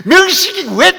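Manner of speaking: calling a dead brother in a high shout; re-enacted anguished holler; grieving yell. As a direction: cry out loudly, demanding an answer. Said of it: "소리 지르면서".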